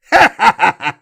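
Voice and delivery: loud, high mocking laugh; hearty laugh. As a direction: laugh off the threat scornfully. Boss laughs off the heroes.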